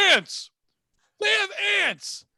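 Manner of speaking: high, loud, drawn-out shout; imitating someone yelling in excitement; imitated excited screaming. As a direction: scream it out like an overexcited customer. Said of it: "And he's screaming that".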